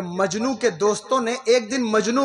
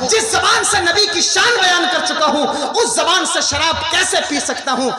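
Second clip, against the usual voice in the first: high, raised impassioned declamation; retold tearful outcry; 6 semitones higher, 5 dB louder than usual. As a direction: imitate an anguished vow, preacher style. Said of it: "روتے ہوئے شراب کی bottle توڑ کر کہا".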